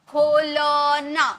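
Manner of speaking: खोलना said very slowly, syllable by syllable. Shown as a demonstration. Teacher breaking down खोलना slowly for students. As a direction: stretch खोलना out slowly so each part is heard. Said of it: "इसमें अ का sound नहीं आ रहा".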